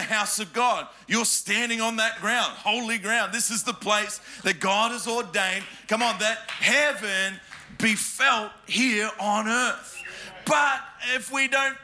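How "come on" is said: ordinary delivery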